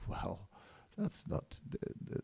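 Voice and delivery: whispered, faltering; hushed hesitation. Retold or imitated. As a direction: barely voice the stammer. Narada hesitant reply.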